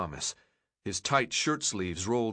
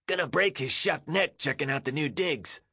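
higher scratchy taunting voice; 5 semitones higher than usual. A narrator's reading. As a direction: scratch out a sneering jeer. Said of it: "a scratchy voice said".

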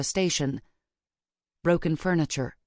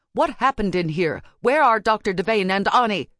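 raised, insistent questioning; firm; 5 semitones higher, 5 dB louder than usual. A narrator's reading. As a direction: demand answers firmly and urgently. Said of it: "she says firmly".

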